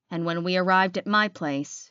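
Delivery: plain reading voice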